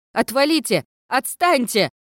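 loud high panicked yell. A narrator's reading. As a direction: scream them off in panic. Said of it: "завопила я".

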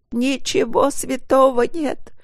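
high, slow, drawn-out wail. A narrator's reading. Wife wails at husband that nothing is sacred to him. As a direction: howl the accusation in despair. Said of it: "провыла жена".

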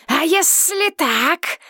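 slow, high taunting dragon. Acted. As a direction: tease like a sly dragon. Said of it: "ответил дракон".

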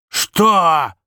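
high, loud, outraged bellow; enraged shout. A narrator's reading. Father enraged at his wife's offer, furious shout. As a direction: explode in disbelief.

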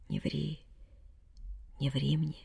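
almost whispered, very soft; near whisper. A narrator's reading. Dying mother almost whispering to her daughter. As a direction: barely breathe it out. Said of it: "почти прошептала мать".

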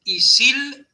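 "y sil-" con SIL agudo y sostenido; acento en la segunda sílaba. Shown as a demonstration. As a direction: sube y alarga SIL en "y sil-". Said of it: "puede ser fuerte la segunda sílaba". ("y sil-" con golpe en SIL)

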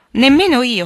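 loud, high, eager agreement; echoing exclamation. A narrator's reading. The other sisters echo Jo's refusal, exclaiming. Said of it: "fecero eco le altre".

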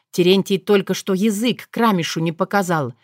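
plain reading voice

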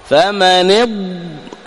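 very slow فمن اض, stopping on the ض; isolated ض. Shown as a demonstration. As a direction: hold the ض and stop. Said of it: "هذا الضاد".

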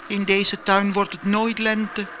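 high little flower voices; calling snowdrops. Acted. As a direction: call out like tiny snowdrops. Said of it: "riepen de sneeuwklokjes".

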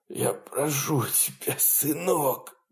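soft, slow, rasping plea; dying father wheezes. Acted. Dying father wheezes a plea to his son. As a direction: breathe the words out. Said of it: "продолжал хрипеть папа".

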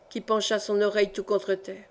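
ordinary delivery

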